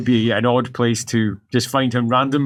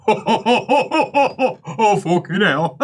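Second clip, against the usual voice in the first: higher voice breaking up with laughter; acted helpless laughter; 7 semitones higher, much slower than usual. Neighbour overhearing the initiates, acted helpless laughing. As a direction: gasp it out while cracking up. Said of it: "you hear from the other".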